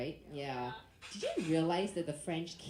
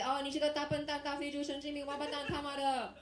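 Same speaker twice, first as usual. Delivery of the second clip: higher-pitched mock tirade in Chinese; cursing in Chinese; 7 semitones higher than usual. Comic answers the French guy in Chinese, high mock tirade. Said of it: "I speak to them in Chinese".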